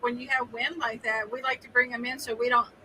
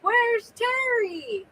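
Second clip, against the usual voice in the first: high, drawn-out slow mock question; imitating someone asking; 11 semitones higher, much slower than usual. Mimicking a friend asking where Terry is, high and slow. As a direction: stretch it out high like a puzzled caller. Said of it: "he's like".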